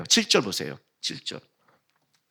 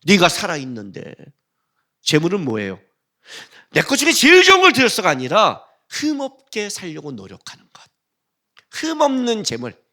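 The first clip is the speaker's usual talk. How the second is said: ordinary delivery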